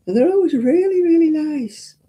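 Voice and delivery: high, loud gush of praise; high excited gushing. Real, not acted. Gushing about helpful staff.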